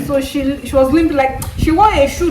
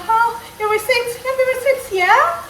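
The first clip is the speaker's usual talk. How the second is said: much higher, sing-song shop assistant; 11 semitones higher than usual. Retold or imitated. Shop worker asking for the receipt, higher and sing-song. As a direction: play the friendly cashier asking.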